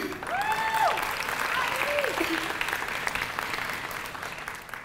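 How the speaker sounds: high, bubbling nervous giggle; a quick, high embarrassed laugh. Real, not acted. Student speaker breaks off her thank-yous with a high nervous giggle.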